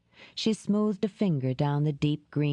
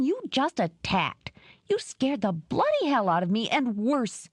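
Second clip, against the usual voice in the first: higher-voiced woman venting hurt; Dora's voice; 5 semitones higher than usual. Woman accusing the neighbor who attacked her, raised voice. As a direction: let the accusations pour out at him.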